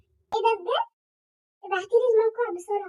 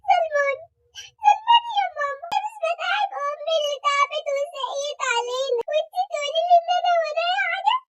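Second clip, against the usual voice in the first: hurt, protesting shout; 6 semitones higher, 3 dB louder than usual. Acted. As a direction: voice up high and loud, wounded. Fake offended outburst. (صرخة زعل مصطنعة)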